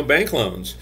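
usual speaking voice

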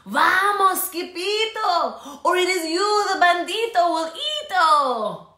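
high, loud urgent warning; urgent alarm. Acted. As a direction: hurry him away in panic. Urgent warning as chimichangos scatter.

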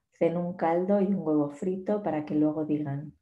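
ordinary talk, nothing marked

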